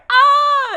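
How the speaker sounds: high, loud startled yelp; startled scream. Retold or imitated. Friend acting Adam fleeing the hissing possum, a loud high yelp. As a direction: yelp like you just got hissed at. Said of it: "He's like".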